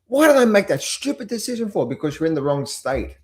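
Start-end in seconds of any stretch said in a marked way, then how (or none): 0.1-1.9 cranky. Retold or imitated. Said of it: "you get cranky".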